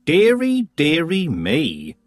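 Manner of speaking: a hammed-up dearie, slowed and drawn out; mock dismay. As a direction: feign shock, slowly. Granny mocks concern.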